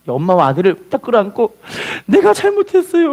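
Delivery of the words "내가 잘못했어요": crying confession. Retold or imitated. Attacker hugging mother and son, acted tearful confession. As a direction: imitate a man wailing an apology. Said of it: "하고 울어요".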